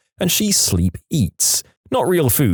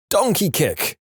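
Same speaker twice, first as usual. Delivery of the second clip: mock shout; 7 semitones higher than usual. A narrator's reading. Wife kicking in her sleep, mock shouting. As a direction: call it out suddenly and high. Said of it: "suddenly shouted".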